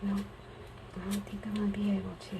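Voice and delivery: unvoiced whisper to herself. Real, not acted. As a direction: breathe it out under your breath. Whispering to herself while crafting.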